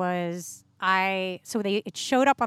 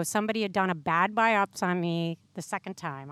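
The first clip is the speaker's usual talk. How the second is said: ordinary talk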